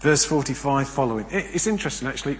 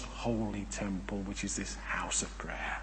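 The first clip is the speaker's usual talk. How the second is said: whispered aside; 6 semitones lower, 11 dB quieter than usual. Acted. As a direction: drop to a whisper. Sermon aside, voice drops to whisper.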